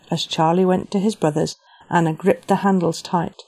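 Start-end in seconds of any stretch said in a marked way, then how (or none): none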